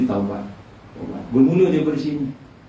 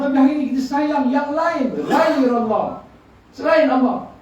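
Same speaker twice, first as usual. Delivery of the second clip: impassioned preaching; 12 semitones higher than usual. Acted. Preacher pleading with the congregation, impassioned full-force sermon.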